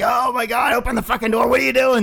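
high, loud panicked parent voices; mock parents panicking at the locked door; frantic, high-pitched mock alarm; parents freaking out. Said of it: "They're all freaking out".

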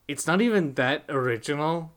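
usual speaking voice

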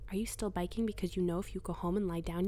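usual speaking voice

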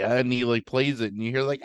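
ordinary delivery

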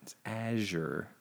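"Azure" drawn out softly as "AZH-er"; insistent zh in Azure. Shown as a demonstration. Answering that there's no J, "Azure" as "AZH-er". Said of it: "The word is pronounced Azure".

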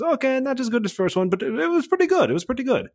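high-pitched imitation of the coach; coach's verdict. Coach rating the maneuver to his pilot, high-pitched mimicry. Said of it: "He goes".

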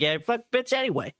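high mocking voice for the guy; mock rude guy. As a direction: sneer it out like the jerk. Mocking the guy's rude reply.

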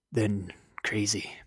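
slow breathy whisper. Pretend. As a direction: whisper it slowly. Whispered after build.

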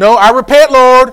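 loud cry of repentance. Acted. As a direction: call out to God at full voice. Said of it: "say it out loud".

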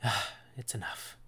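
low, soft sigh of defeat; quiet, deflated exasperation; weary exasperation. Acted. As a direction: sigh it out, giving up on them. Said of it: "you can almost hear the exasperation".

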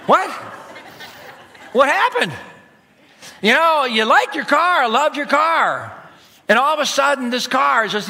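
preacher to congregation, hammed-up disbelief; incredulous. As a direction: act stunned at the sudden change of heart.

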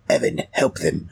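low hushed command; whispered order. Acted. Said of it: "I turn back and go".

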